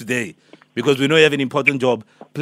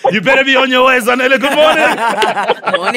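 higher, louder gleeful reveal; excited reveal; 10 semitones higher, 6 dB louder, slower than usual. Acted. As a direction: burst out with the big reveal. Prank reveal to listener.

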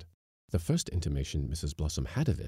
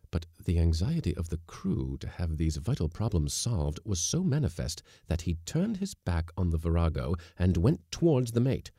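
ordinary delivery, nothing marked

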